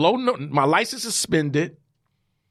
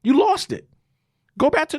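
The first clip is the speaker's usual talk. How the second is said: high, insistent voice of God; God telling him to go back to New York, higher; God's voice; 9 semitones higher, faster than usual. Retold or imitated. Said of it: "He said".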